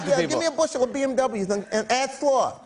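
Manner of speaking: high, lisping boxer impression; Mike Tyson. Boxer buying cars in bulk, Mike Tyson impression. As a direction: order grandly in a high lisp. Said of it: "Mike was so rich".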